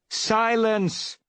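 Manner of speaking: sharp, high, loud command for silence; commanding shout. A narrator's reading. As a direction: bark the order to silence the crowd. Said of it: "ordered Bluestar".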